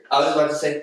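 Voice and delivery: normal talking voice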